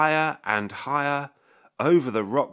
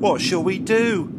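worried question; 9 semitones higher, 4 dB louder than usual. A narrator's reading. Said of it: "asked Mum".

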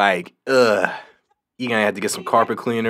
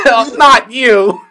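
laughing; 14 semitones higher, 12 dB louder than usual. Real, not acted. Laughing reaction.

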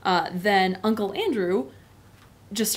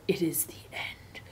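hushed, slow whisper of doom; despairing whisper; 2 semitones lower, 7 dB quieter than usual. Retold or imitated. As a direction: breathe it out hopelessly. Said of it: "just total despair".

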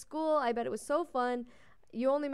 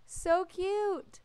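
high cooing; 8 semitones higher, 4 dB louder than usual. Real, not acted. Continued cooing.

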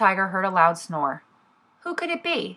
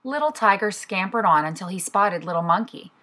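usual speaking voice

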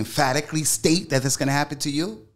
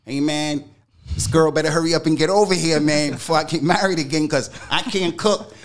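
loud, fast complaining rant, higher; 5 semitones higher, 6 dB louder than usual. Retold or imitated. Telling how he complained to his son about the cooking. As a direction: whine it loud and quick about not cooking.

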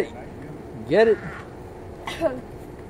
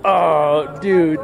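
usual speaking voice

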